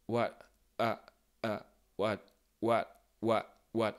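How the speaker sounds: what ending in a glottal stop, the air blocked. Shown as a demonstration. Teacher drilling the glottal stop at the end of what. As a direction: cut off what in the throat. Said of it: "un coup de glotte".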